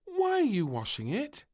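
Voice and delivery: high, loud puzzled question; a girl who doesn't get it; piping puzzled ask; confused princess. A narrator's reading. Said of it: "looked confused".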